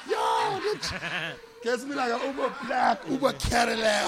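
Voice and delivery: high, loud, drawn-out amazement; excited shouting. Retold or imitated. Teller boasting of his free luxury ride, excited. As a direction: hoot it through a laugh.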